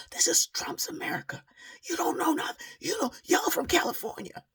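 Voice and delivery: very high, loud imitated yelling; mock shriek. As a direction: shriek it like the angry woman. Woman yelling at mother.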